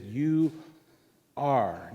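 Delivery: "You" then a pause, "are" pressed and falling; stress on ARE. Shown as a demonstration. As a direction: lean hard on "are" after a beat. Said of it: "Now, I stress that word".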